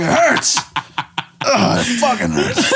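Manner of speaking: high, hammed-up whine of pain; mock pain. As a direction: whine through a comic groan. Acting out pain of bareback riding.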